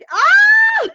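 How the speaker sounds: high, loud delighted squeal; sudden shriek of delight; excited shriek. Real, not acted. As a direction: burst out in joy. Reacting to a viewer comment.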